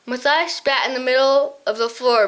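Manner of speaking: usual speaking voice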